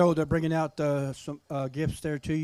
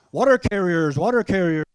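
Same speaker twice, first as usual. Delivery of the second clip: powwow emcee; 3 semitones higher, 8 dB louder than usual. Acted. Emcee calls water carriers.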